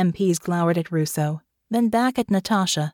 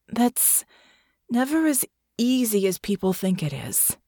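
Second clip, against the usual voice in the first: tired; 4 semitones higher than usual. A narrator's reading. Russo answering Natasha's plea, tired. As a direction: let the fatigue show lightly. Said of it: "Russo said tiredly".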